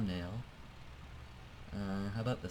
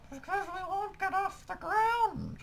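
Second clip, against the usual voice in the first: silly voice; slower than usual. Pretend. Mock reply in a silly voice.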